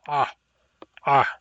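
tight choked ah; strangled pharyngeal stop. Shown as a demonstration. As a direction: squeeze the throat shut on each ah. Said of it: "like you're being strangled".

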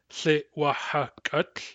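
Tlitwahakut stretched out, K starting the last syllable; very slow model. Shown as a demonstration. Teacher showing the moved K, very slowly. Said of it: "for example".

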